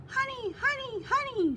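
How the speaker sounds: very high, grinning bird voice; gleeful bird call. A narrator's reading. Honey Guide luring Badger toward the baobab, gleeful high call. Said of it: "she cried, grinning".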